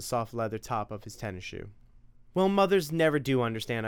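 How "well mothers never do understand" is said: higher-voiced mom, quick and fed up; a mother scolding her son about morning video games; nagging mother. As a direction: lay down the house rules.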